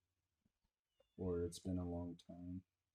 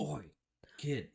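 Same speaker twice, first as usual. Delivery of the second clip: loud rough call, higher; gruff dad voice; 7 semitones higher, 5 dB louder than usual. Pretend. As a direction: bark it like a tough dad. Mock gruff dad greeting.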